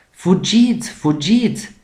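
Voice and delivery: high, loud warning cry; alarmed shout. A narrator's reading. Bear cub warning the others of the iceberg, shouting. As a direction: yell to the others to run. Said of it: "strigă un pui de urs".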